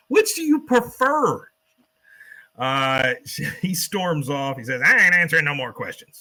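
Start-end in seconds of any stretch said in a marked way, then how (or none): 0.1-1.5 much higher, louder, slow dramatic question; hammed-up interviewer. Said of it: "And gives him the". 4.8-6.2 put-on louder gruff old man refusing; cranky refusal. Cotton storms off angrily.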